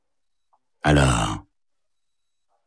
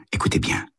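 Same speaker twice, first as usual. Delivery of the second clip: hushed whisper, listen to the clock; soft unvoiced hush; 4 dB quieter, faster than usual. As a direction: whisper it to draw him in close. Detective hushes to make him listen.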